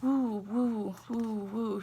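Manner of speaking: falling hooted woo like an owl. A narrator's reading. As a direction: hoot it over and over. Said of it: "like a hooting of an owl".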